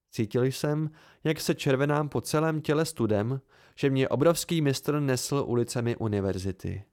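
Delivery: plain reading voice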